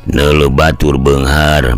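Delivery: ordinary delivery, nothing marked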